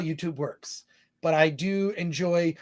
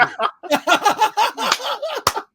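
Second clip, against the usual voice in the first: real, much higher burst of laughter; high laughing fit; 4 dB louder than usual. Real, not acted. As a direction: let the laugh rise up high. Laughs at pun super chat.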